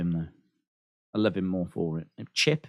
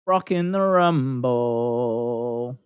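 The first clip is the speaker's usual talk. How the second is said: drawn out; 7 semitones lower, much slower than usual. Acted. Dramatic repeat of news.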